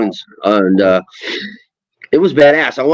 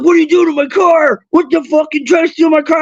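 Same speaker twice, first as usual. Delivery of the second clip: high-pitched furious yell, much higher; angry stranger yelling; 13 semitones higher than usual. Retold or imitated. Stranger at the mall yells at a teen over his car.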